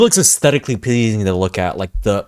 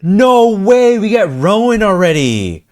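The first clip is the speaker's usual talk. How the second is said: excited shout; 9 semitones higher, 5 dB louder than usual. Real, not acted. Gamer reacting to surprise boss.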